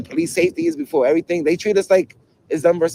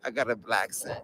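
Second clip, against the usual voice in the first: low, much softer repeat; quiet musing; 8 semitones lower, 13 dB quieter than usual. Real, not acted. Streamer mulling over the accent label alone, quietly musing. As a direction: say it low, to yourself.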